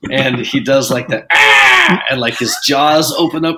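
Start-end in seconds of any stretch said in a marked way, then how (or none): 1.3-2.0 sudden very high, loud "ah" screech; weird creature shriek. Retold or imitated. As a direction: imitate a movie monster's shriek. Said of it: "he makes, like, a weird, like".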